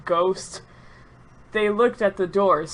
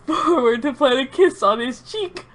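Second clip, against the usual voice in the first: ordinary delivery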